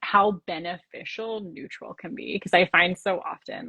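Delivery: usual speaking voice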